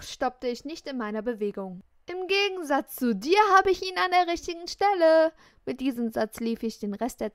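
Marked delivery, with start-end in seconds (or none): 2.1-5.3 loud retort. Acted. Parting retort on the stairs.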